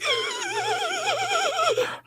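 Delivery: sharp high gasping breath in; imitated gasp. Cop imitates the woman's frantic breathing with a gasp. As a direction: suck air in like she's panicking. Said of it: "she's doing this breathing like".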